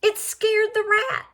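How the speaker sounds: ordinary delivery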